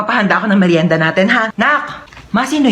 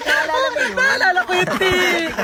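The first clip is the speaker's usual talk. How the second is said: high-pitched giggling through the words; laughing hard; 9 semitones higher, 4 dB quieter than usual. Acted. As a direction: squeal with laughter while reminiscing. Friends recalling a funny scream. (tawang-tawa habang nagsasalita)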